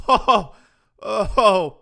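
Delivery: high, very loud "oh" cries; loud outburst. Real, not acted. Host cracking up at the news video, high loud cries. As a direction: cry out "oh" between bursts of laughter. Host erupts between bursts of laughter.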